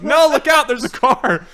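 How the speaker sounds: loud, high-pitched warning yell; mock alarmed shout. Friends see Cool Cat dart into traffic, mock alarmed shout. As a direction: shout an imitated panicky warning.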